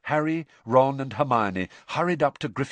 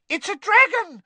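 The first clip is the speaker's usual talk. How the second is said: very high, loud squeal; panicked shriek; 9 dB louder than usual. A narrator's reading. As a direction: shriek like a child losing her head. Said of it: "shrieked one of the first years, losing her head completely".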